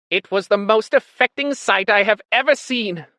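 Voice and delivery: high, loud, theatrical lament; tearful Squealer. A narrator's reading. Squealer announcing Boxer's death, showily moved. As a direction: make a show of being moved. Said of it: "wiping away a tear".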